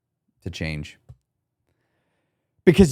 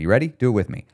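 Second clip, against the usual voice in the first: ordinary talk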